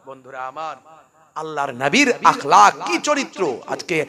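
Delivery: fiery sermon; preaching at full force. Acted. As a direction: rouse the congregation.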